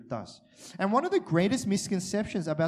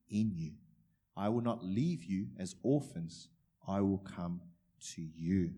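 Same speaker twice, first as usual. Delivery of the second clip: scripture reading; 5 semitones lower than usual. Acted. Reads scripture softly.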